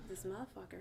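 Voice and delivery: laughing muttered insult at husband; muttered aside. Retold or imitated. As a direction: say it under your breath, amused. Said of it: "and I was like".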